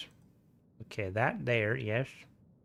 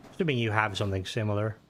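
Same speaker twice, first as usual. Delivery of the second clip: ordinary delivery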